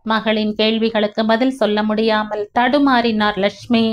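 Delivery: usual speaking voice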